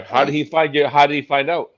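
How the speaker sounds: ordinary talk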